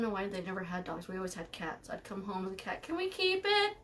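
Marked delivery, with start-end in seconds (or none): 2.8-3.8 high-pitched, hopeful begging; pleading kid. Retold or imitated. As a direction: plead like a kid asking to keep a pet. Voicing her pleading younger self.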